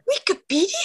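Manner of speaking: lightly voiced Henry Cavill; a hint of Henry Cavill saying Wikipedia; Henry Cavill impression. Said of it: "uma impressionant do Harry Cavill".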